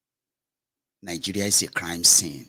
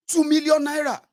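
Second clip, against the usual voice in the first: much higher, aggrieved shopkeeper telling it; businessman; 5 dB louder than usual. Retold or imitated. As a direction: tell it high-pitched and aggrieved. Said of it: "businessman answers".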